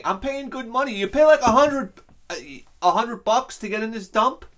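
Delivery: loud, heated complaint, rising in pitch; angry rant. Real, not acted. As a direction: rant about being ripped off. Ranting about aquarium prices.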